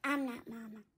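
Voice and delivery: low, soft worried pet voice; timid cat Tigger. Acted. Tigger protests.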